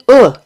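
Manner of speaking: "u" as the short sound in "good"; "u" as an isolated "uh" with rounded lips, as in "good"; rounded vowel. Shown as a demonstration. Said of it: "as in good".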